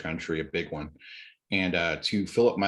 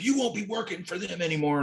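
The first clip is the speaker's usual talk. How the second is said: high, mocking imitation of the man; a hammed-up snooty customer; 11 semitones higher than usual. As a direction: lift the voice and sneer out his threat.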